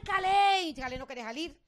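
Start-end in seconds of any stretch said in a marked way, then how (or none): 0.0-0.6 drawn-out, higher, louder call; imitated urgent holler, stretched long; retold shout. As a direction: reenact yelling for someone to come out. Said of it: "yo grito, no, estoy gritando".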